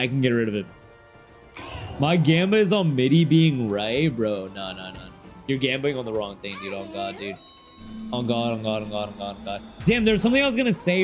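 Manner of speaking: usual speaking voice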